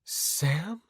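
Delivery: dazed and groggy. Acted. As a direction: call out weakly, half awake. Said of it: "she said groggily".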